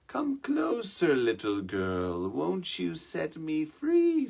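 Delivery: lightly voiced coaxing beast, drawn out slowly; wheedling mountain lion. Mountain lion coaxing the trapped girl, slow and sweet. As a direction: coax the little girl closer, sweet and slow. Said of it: "says the mountain lion".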